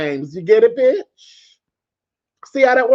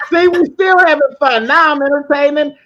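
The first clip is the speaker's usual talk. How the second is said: higher, louder gloating; gleeful gloat; 8 semitones higher, 4 dB louder than usual. Real, not acted. Gloating to the troll that the stream is having fun. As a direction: crow about it playfully.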